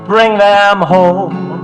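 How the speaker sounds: ordinary talk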